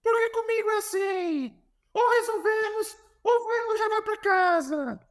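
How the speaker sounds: imitated coach's ultimatum, very high-pitched; Jorge Jesus confronting. Coach confronts player in his office, high-pitched mock ultimatum. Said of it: "aí a hora ele tirou o óculos".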